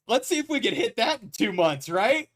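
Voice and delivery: usual speaking voice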